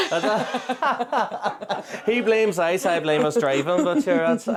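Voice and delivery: talking through laughter. Real, not acted. Joking with a friend about blame for a crash, talking through laughter. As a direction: let the laugh interrupt the words.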